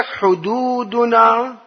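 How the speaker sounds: drawn-out حدودنا, very slow; slow stress. Acted. Preacher dwelling on حدودنا, very slow. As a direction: stretch حدودنا to make them think. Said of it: "حدودنا يعني شنو".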